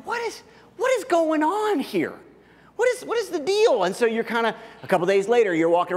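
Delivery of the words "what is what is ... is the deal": much higher, incredulous asking; baffled exasperation. Acted. Snubbed again by the neighbor, asking in high disbelief. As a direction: throw up your hands in disbelief.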